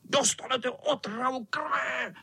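high cat threat, normal volume; mock shriek of a wicked cat; shrieking tomcat. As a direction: squeal it as a gleeful threat. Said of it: "křičel".

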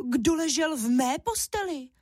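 cross mother bear, high-pitched; indignant storybook she-bear; Máša. A narrator's reading. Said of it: "zlobila se Máša hlasem medvědí maminky".

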